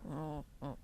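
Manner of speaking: a low, soft frightened oh; softly dreading being caught; terrified. Retold or imitated. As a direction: caught out and scared. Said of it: "I was like".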